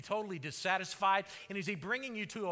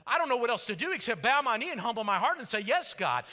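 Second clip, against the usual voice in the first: fervent surrender; 7 semitones higher, 12 dB louder, faster than usual. Pretend. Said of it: "you're gonna go".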